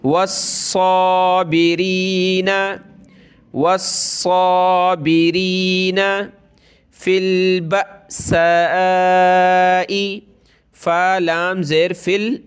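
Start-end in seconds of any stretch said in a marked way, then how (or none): 0.0-6.3 वस्साबिरीना with a thick doubled साद and a long vowel; slow chanted वस्साबिरीना, heavy साद; heavy doubled sad. Shown as a demonstration. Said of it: "इसको सख्त करेंगे तशदीद की वजह से और खींचेंगे". 7.0-10.2 फिल बअसाई with a sharp glottal catch at the हमज़ा; slow recitation of फिल बअसाई with a jolting हमज़ा; hamza jerk. Shown as a demonstration. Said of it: "हमज़ा साकिना झटका देकर पढ़ा जाता है".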